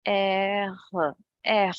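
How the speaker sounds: "air" with a guttural French R from the throat. Shown as a demonstration. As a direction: say "air" with the R scraped in the throat, between R and K. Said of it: "It is spoken like this".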